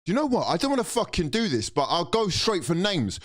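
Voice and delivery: normal talking voice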